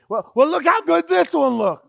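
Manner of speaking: high, loud, eager pointing out; loud eager prophet showing off his pick; excited Samuel. Retold or imitated. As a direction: hype up the tall candidate to God. Samuel voiced again.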